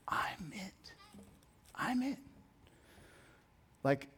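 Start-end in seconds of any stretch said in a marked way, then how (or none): 0.1-2.2 soft whisper for emphasis; hushed declaration. Retold or imitated. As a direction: let it out as a quiet, near-whispered claim. Preacher dramatizes Jesus' claim softly.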